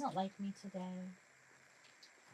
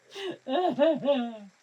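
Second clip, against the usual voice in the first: laughing sob; 6 semitones higher, 10 dB louder than usual. Pretend. Breaks off fake crying.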